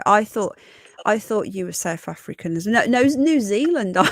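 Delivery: ordinary talk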